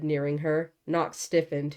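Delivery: usual speaking voice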